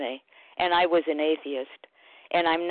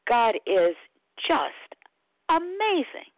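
rising excitement; 6 semitones higher, slower than usual. Real, not acted. Sharing with the group, voice soaring on amazing. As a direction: swell up with real wonder on the last word. Rising joy about her higher power.

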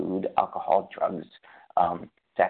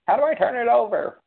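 much higher, louder rhetorical question; emphatic question; 7 dB louder than usual. Real, not acted. Asking the group how to turn it over, high and loud. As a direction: throw the question at the room.

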